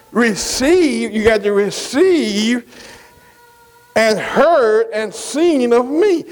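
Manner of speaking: slow, drawn-out words; slow emphatic preaching; slow and deliberate. Shown as a demonstration. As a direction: lay each word down slowly for the church. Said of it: "Underline that word".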